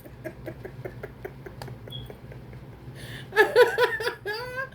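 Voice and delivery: high, airy, breathless laughter; real laughter; high breathy laugh. Real, not acted. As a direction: giggle it out, out of breath. Laughing after the head-hurt line.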